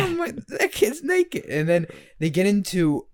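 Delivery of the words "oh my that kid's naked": mock horrified mother-in-law; shocked old lady. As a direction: gasp it out like a scandalised grandmother.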